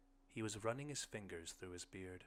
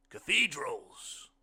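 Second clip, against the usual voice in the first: drawn-out, much louder blind man's voice; 6 semitones higher, 12 dB louder, much slower than usual. Acted. Blind man musing aloud to his host, slow and loud. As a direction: stretch the word out slowly and loudly as the blind man. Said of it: "the blind man said".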